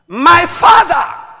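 loud, high, drawn-out call; shouted cry. Acted. Elisha calling to Elijah in the whirlwind, shouting. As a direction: cry out after someone being carried away. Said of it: "He shouted".

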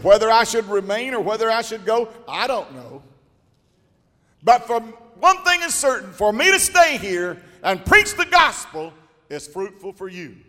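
loud, high preaching cry; full-force preaching. Acted. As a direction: preach it out with fire.

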